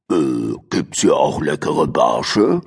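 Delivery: low, hungry crocodile voice; a big reptile sniffing for fish, low; curious crocodile. A narrator's reading. Crocodile Rick asking about fish, low and curious. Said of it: "Rick, das Krokodil, schaute ihr neugierig nach".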